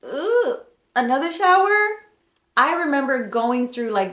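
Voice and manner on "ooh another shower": high-pitched, mock-appalled question; retold dismay. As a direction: exclaim it high and loud, in comic disbelief. Reenacts dismay at smelling again.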